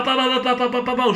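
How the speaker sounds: rapid-fire vocal gun noises; imitated gunfire. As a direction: rattle off shots like a machine gun. Imitating gunfire in film retelling.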